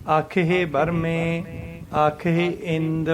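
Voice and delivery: slow recitation, a clear pause after ਬਰਮੇ; drawn-out ਆਖੇ, then stop on ਬਰਮੇ before ਆਖੇ ਇੰਦ; paced scripture reading resting at ਬਰਮੇ. Shown as a demonstration. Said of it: "ਇੱਥੇ ਬਰਮੇ ਦੇ ਉੱਪਰ ਵਿਸ਼ਰਾਮ ਆਊਗਾ".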